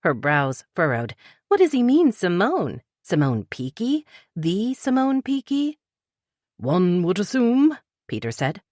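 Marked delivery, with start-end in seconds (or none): 1.5-5.8 rising, high-pitched disbelief; stunned, demanding Simone Peaky; incredulous demand. A narrator's reading. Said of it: "Tess demanded". 6.6-7.8 polite British. A narrator's reading. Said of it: "politeness in his clipped British voice".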